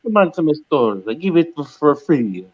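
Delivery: high, hammed-up Italian shopkeeper pitch; mock Italian accent. Asked to talk Italian, mock Italian shop pitch. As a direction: play an Italian selling meatballs, high-pitched. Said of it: "Talk me a little Boston or what's that".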